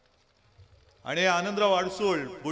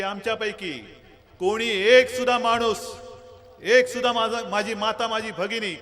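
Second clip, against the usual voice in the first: rally speech. Real, not acted. Addresses crowd at campaign rally.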